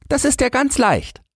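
put-on very high child's voice; stalling boy. A narrator's reading. Boy stalls before answering the sum, feigned ease. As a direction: play a little boy buying time. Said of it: "um Zeit zu gewinnen".

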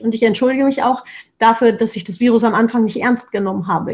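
usual speaking voice

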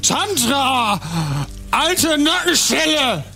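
put-on high, slow, drunken greeting; blackout drunk. (sturzbesoffene Begrüßung)

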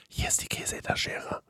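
toneless whisper. Retold or imitated. Waitress serving during the film, whispered. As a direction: hush it like in a dark cinema.

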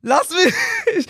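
very high, whiny comeback; mock protest. Son fending off father's criticism, mock whining. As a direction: re-enact a pestered kid protesting.

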